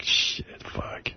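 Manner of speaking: unvoiced, barely audible cursing; whispered curse. Passing the heckler with a girl around, whispered cursing. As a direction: breathe the curses under your breath. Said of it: "it's just like".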